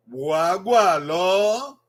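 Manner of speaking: slow, gentle repeat of "Wagwa lo". Shown as a demonstration. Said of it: "you say, "Wagwa lo".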